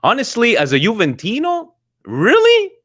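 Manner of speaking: incredulous. Real, not acted. Incredulous reaction to fan comment.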